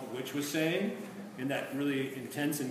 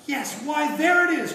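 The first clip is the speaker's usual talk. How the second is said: much higher, louder sudden realization; excited cry; 12 semitones higher, 11 dB louder than usual. A narrator's reading. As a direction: cry it out, waking from the spell. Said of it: "cried the prince".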